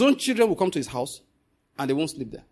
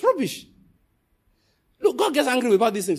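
fiery preaching; 5 semitones higher than usual. Real, not acted. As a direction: scold them with full fire. Preaching at full force.

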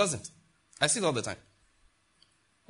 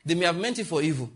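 ordinary talk, nothing marked

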